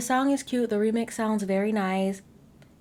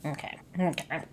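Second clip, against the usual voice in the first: ordinary delivery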